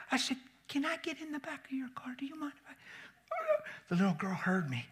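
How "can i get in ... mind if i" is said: high, soft unvoiced whisper; preacher quietly asking to sit with the child. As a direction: ask it gently and hushed. Softly asking grandma.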